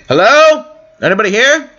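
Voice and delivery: loud, high call with rising pitch; searching shout into empty space. Pretend. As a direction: call out to see if anyone is around. Calling into an empty town.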